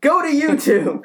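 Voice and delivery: exasperated mock yell at a machine; imitated screaming. Neighbour retrying his Xbox command, mock screaming. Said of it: "he's just screaming his head off".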